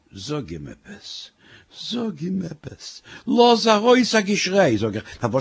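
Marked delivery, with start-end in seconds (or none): 0.1-1.3 low, soft, slow urging; slow secret plea, kept down low; hushed nudging. Acted. Wife nudging husband in bed. 1.7-3.0 higher, soft, drawn-out plea; slow hushed pleading, pitched up; hushed insistent nudging. Acted. Wife urges again, thief nearby. 3.3-4.8 high, louder burst of urging; agitated wife's raised voice; urgent urging. Acted. Wife urges a scream.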